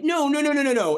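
very high, rapid-fire protest; hasty denial. Retold or imitated. Answering roommate mid-movie, hasty retold denial. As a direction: wave the question away in a high-pitched rush.

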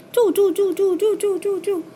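high chirping bird calls. Acted. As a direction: chirp it out like noisy little birds. Birds chirping noisily.

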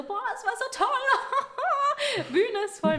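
high, slow, dreamy gush; gushing candidates. Retold or imitated. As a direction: swoon over how great it was. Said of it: "meinen so". (hoch, gedehnt und schwärmerisch)

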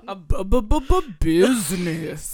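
loud stammered mock-grave voice; serious voice. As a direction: sputter it out in a serious voice. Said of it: "that's your serious voice".

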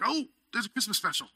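sudden high excited gasp of memory; light mock surprise, quick; surprised realization. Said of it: "I'm like".

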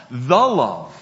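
"The love" with a loud, punched "The"; stress on THE. Shown as a demonstration. Preacher pointing to the true love, emphatic stress on "The". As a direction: hit "The" hard, as the one and only. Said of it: "John includes a definite article".

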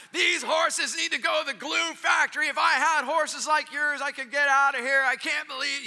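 high, loud, furious rant; angry farmer ranting. Retold or imitated. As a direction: vent your rage at the useless horses. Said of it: "and I'm sure he was like".

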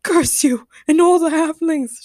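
high, loud theatrical curse; yell. A narrator's reading. Sean curses no one in particular, yelling. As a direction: yell it at the heavens. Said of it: "Sean yelled to no one".

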